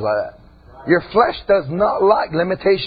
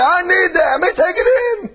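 tearful cry; 13 semitones higher than usual. Acted. Worshipper crying out to take in the preaching. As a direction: cry it out, almost laughing.

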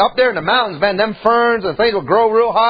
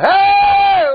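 high, loud, drawn-out call; yelling; 10 semitones higher, 5 dB louder than usual. Acted. Lost boy calling out in the mountains, yelling. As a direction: holler out for anyone who might hear. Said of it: "We started yelling".

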